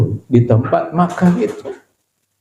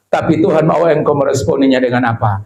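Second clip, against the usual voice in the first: ordinary talk, nothing marked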